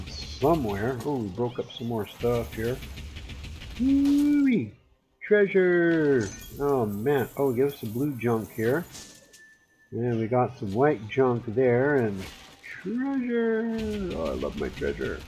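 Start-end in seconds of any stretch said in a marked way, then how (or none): 3.8-6.3 high, loud gleeful cheer; excited whoop. Real, not acted. Excited loot find. 12.6-13.8 a high squeak on treasure; high squeaky treasure. Pretend. Excited loot find, high voice. 14.2-15.2 a low, soft miser voice; lightly voiced greedy miser. Gloating over loot in low voice.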